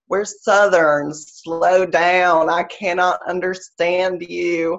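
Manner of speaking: a slow, drawn-out Southern plea. Retold or imitated. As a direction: stretch it out to slow her down. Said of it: "sometimes I have to say".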